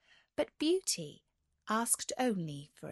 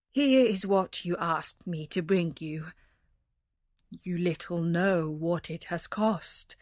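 subdued father, heavy with regret; sad; 3 semitones lower than usual. A narrator's reading. Merchant gives Beauty her costly rose, sad voice. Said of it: "he said sadly".

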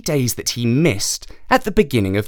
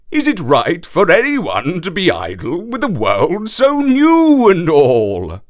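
high horse voice; 6 semitones higher, 4 dB louder than usual. Acted. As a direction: stretch out the last words as the horse. Horse voice in the story.

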